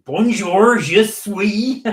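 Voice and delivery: put-on French in a broad, loud, high West Country accent. Mocking the French teacher with a West Country accent. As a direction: ham up Bonjour in a thick West Country accent. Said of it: "strongest West Country accent you could do".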